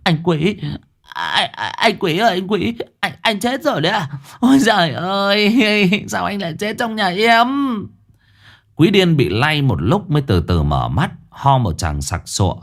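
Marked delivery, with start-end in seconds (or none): 0.0-7.5 high, shaky, panicked calls; frantic, half-hysterical wailing; trembling panic. Acted. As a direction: shake him and cry out in fright. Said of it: "run bần bật lay".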